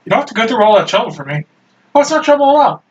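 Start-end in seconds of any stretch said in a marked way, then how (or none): 1.9-2.8 put-on bright cheerful girl.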